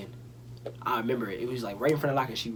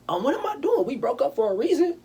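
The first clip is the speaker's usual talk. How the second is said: high-pitched mock self-questioning; comic incredulous squeal; sudden realization; 13 semitones higher, 4 dB louder than usual. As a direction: pitch it way up, baffled at yourself. Acting out own sudden realization.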